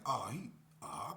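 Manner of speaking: breath-only startled whisper; realising it's serious; alarmed whisper. Retold or imitated. Narrator to himself seeing K P cry, startled whisper.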